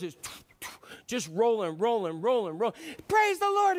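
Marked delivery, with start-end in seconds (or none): 3.1-3.8 high, loud cry of joy; shout. Acted. Rider on a racing horse, high loud cry. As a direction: cheer it out at full speed.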